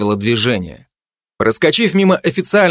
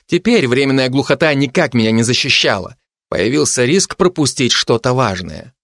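plain reading voice

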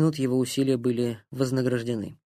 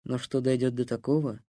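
usual speaking voice

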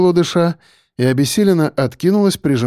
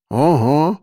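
lightly muffled mumble; gum-chewing mumble. A narrator's reading. Sidekick agreeing with his friend, mumbled while chewing. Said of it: "пробубнил тот, перемалывая во рту жвачку".

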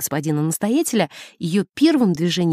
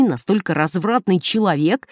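plain reading voice

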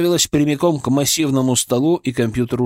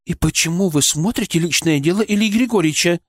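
steely suspicion; 6 semitones higher than usual. A narrator's reading. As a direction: press her with steel in voice. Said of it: "стальные нотки подозрения".

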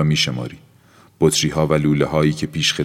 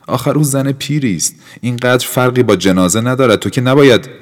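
much higher and louder protest; heated outburst; 9 semitones higher, 7 dB louder than usual. Acted. Felipe protesting Aura's sacrifice, heated. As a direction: burst out against the old woman. Felipe argues against the aunt.

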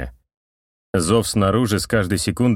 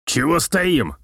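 impatient; 7 semitones higher, slower than usual. A narrator's reading. Artyom hurrying the others.

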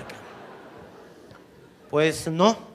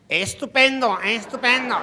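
put-on high, loud prim old woman; hammed-up posh old mother; prim old lady; 10 semitones higher, 7 dB louder than usual. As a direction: gush it like a stiff society matron. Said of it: "la vieja le decía".

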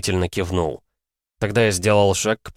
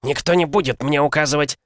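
furious shout; 8 semitones higher than usual. Acted. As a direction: yell it, refusing orders. Merchant roaring in outrage.

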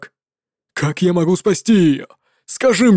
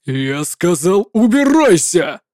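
shout; 3 semitones lower, slower than usual. Acted. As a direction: drag out сказал, then hurl the order. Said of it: "закричал паладин ещё сильнее".

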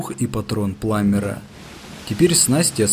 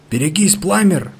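high, loud warning cry; almost squeal the warning; near shriek; 8 semitones higher, 5 dB louder than usual. A narrator's reading. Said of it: "почти взвизгнул я".

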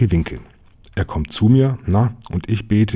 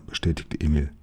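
ordinary delivery, nothing marked

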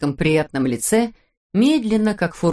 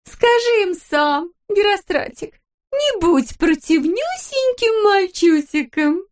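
put-on much higher, slow sugary baby talk; cooing wife; 13 semitones higher, slower than usual. A narrator's reading. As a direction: whine sweetly at the husband. Wife character voice. (высоко, медленно, сюсюкая)

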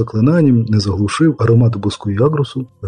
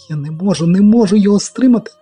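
desperate cry; 8 semitones higher than usual. A narrator's reading. Sorceress failing to hold djinn.

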